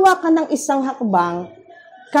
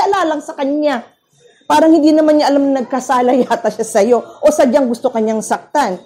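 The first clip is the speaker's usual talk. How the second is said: ordinary talk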